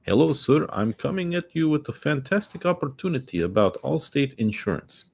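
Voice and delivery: high mock Indian telemarketer accent; lightly mocked Indian sales voice. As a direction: imitate the Indian caller's sales pitch. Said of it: "he's like".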